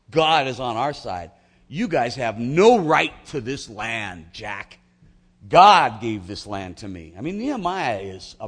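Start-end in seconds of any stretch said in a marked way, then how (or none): 0.1-7.1 slow, loud, defiant declaration; determined defiance. Retold or imitated. Nehemiah defies his scoffing enemies, slow and loud. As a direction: plant your feet and declare it. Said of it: "with great determination".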